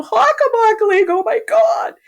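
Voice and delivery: very high, loud mock holler; imitated excited shout. Goalie answering a hometown fan, imitated high shout. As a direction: cry it out in high-pitched amazed recognition, imitating him. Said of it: "I heard his voice go".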